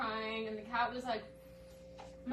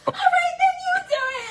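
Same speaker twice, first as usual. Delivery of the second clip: fed-up grandma; 12 dB louder than usual. Retold or imitated. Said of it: "my grandma was like".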